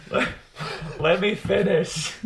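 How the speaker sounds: straining, laughing, breath held in; squeezed out with breath held; holding breath. Real, not acted. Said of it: "talk while holding your breath".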